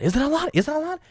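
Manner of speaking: echoing mom's words, doubting himself; self-doubting. Retold or imitated. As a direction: ask yourself in doubt. Questioning himself after mom.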